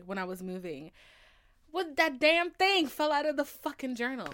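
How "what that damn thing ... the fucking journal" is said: louder, higher exasperation; exasperated outburst. Real, not acted. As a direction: blurt it out, annoyed. Outraged retelling.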